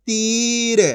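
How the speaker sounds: तीर with a held ī; long drawn-out vowel. Shown as a demonstration. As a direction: stretch तीर into "teeer". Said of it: "खींच के पढ़ेंगे तीर".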